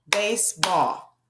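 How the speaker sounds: "Baseball" drawn out slowly, one clap for "Base", one for "ball"; slow clapped word. Shown as a demonstration. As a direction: stretch "Baseball" into two clapped beats. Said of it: "Get ready to clap it out".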